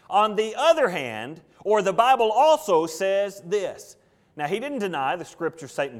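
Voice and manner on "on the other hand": "other" punched higher and louder, slowly; stress on OTHER. Shown as a demonstration. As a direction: lean hard on the word "other". Repeats the key phrase for emphasis.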